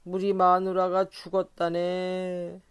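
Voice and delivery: put-on slow, tearful drunken confession; a drunk weeping about his dead wife; sniffling. A narrator's reading. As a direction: sniff through the words. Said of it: "훌쩍훌쩍 울기 시작하였다".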